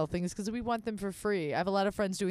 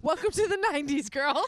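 higher, fast sassy taunt; 7 semitones higher, 4 dB louder than usual. Retold or imitated. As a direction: rub it in, quick and bright. Teasing friend.

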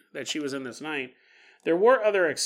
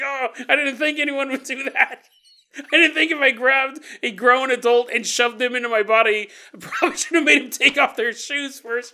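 surprised kangaroo man; 7 semitones higher, 5 dB louder than usual. Acted.

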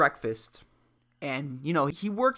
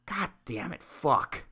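softer, defeated cursing; resigned muttering; 6 semitones lower, 6 dB quieter, slower than usual. Retold or imitated. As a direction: grumble it under your breath. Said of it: "you're like".

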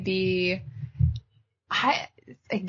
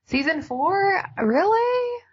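surprised; 12 semitones higher, 5 dB louder than usual. Real, not acted. Reacting to a guess of season four.

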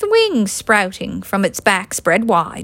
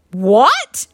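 sharp, louder outburst; demanding; 4 dB louder than usual. Acted. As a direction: fire it back in disbelief. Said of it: "Luke demanded".

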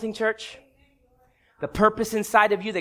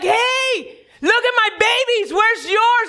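higher, bright-eyed show-off dog voice; a proud mother dog showing off her pups; perky dog voice; 10 semitones higher, slower than usual. Acted. Mother dog bragging to the elephant, high perky voice.

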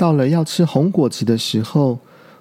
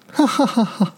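gloating laugh; 8 semitones higher than usual. Acted. 小鹿炫耀大笑.

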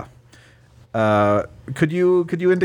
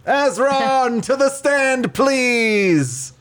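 announcing a witness; 11 semitones higher, much slower than usual. Acted. Calling witness to the stand.

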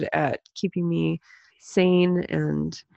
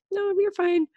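kids' retold reply; 12 semitones higher than usual. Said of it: "they're like".

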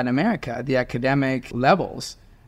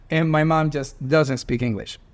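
normal talking voice

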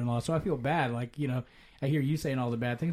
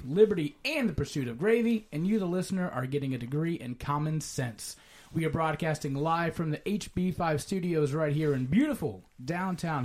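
booming pumped announcer; 6 semitones higher than usual. Acted. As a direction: pump up the crowd like a ring announcer. Podcast host hypes the show intro.

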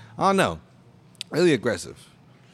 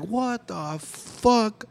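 slow, drawn-out what the fuck; 6 semitones higher, much slower than usual. Retold or imitated. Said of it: "it was like".

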